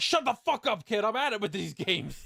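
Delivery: imitated angry outburst, high-pitched; mock fed-up shouting; Kaiba snapping. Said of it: "just started shouting at Noah".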